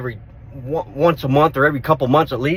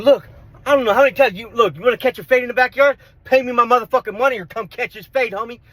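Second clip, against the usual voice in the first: raised, heated voice, higher and louder; retold angry threat; 8 semitones higher, 4 dB louder than usual. Confronting the roommate who owes him, loud and heated. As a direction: fire the threat at the debtor.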